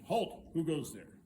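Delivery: loud castle-guard challenge; guard's challenge. Acted. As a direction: call out sharply as a sentry. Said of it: "they said".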